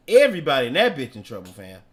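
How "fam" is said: ordinary delivery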